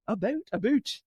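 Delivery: attempted Canadian accent. Shown as a demonstration. Guest asked for Canadian accent, tries "about" in it. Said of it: "Give me a Canadian accent".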